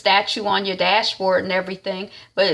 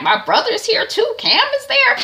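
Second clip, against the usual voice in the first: far higher, squealing disbelief; shocked sister; 5 dB louder than usual. Retold or imitated. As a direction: squeal it in amazement. Sister shocked brother's there.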